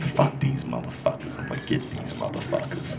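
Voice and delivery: low hushed mock vengeful mutter; muttered vow. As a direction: quietly vow revenge under the breath. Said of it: "I said".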